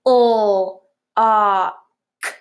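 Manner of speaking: O, a, k apart, the first a held l; sounded out. Shown as a demonstration. Teacher sounding out another word for the class, sounded out. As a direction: hold O as an l sound, then open a, then hard k. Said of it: "here's another one".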